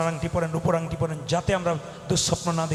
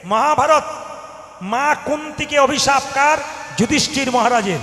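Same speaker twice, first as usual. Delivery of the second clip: loud, higher preaching cry; preaching shout; 5 semitones higher, 9 dB louder than usual. Real, not acted. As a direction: proclaim it at full force to the hall. (উচ্চস্বরে প্রচার)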